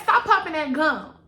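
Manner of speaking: loud, sassy "Stop popping that gum"; sassy scold. Retold or imitated. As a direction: scold like a fed-up auntie. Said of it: "Girl, get that".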